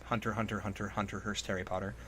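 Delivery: ordinary talk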